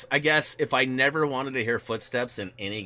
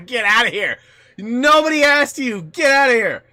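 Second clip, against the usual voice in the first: mock shout; 13 semitones higher, 13 dB louder than usual. Banishing a rival headset brand.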